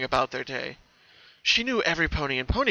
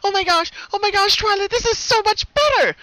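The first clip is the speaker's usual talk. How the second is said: very high, loud squealing joy; very high gleeful squeal; giddy excitement; 9 dB louder than usual. Acted. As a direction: bounce with delight. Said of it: "bounced around the room excitedly".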